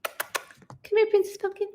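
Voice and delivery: cooing to a cat. Real, not acted.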